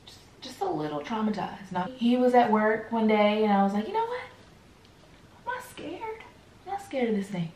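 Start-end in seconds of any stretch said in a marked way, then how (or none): 3.9-7.5 hushed, high-pitched pep talk to yourself; retold self-talk, softly psyching yourself up; mock bravado.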